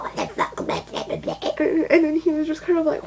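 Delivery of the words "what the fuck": slow, creaky, very low mock voice; mocking imitation. Mocks his reaction to the crying.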